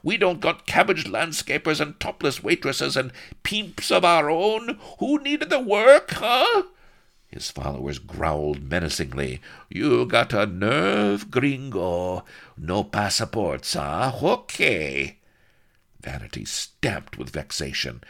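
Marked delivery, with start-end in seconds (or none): 0.0-6.6 loud Spanish rant climbing very high; indignant roar. A narrator's reading. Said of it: "he roared indignantly". 9.7-15.2 put-on slow, raised, Spanish-accented threat; menacing Spanish corporal.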